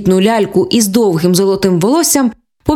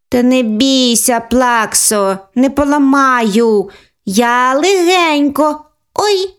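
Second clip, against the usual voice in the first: put-on high child voice, slow then oops; breezy big sister then oops; careless little girl; 4 semitones higher, much slower than usual. Older sister promising to be gentle, high childish voice. Girl takes toy car.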